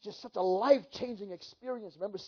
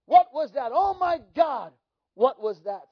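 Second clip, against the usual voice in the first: crying out; 3 semitones higher, 7 dB louder than usual. Retold or imitated. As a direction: shout it out in shock, building. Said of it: "I remember crying out".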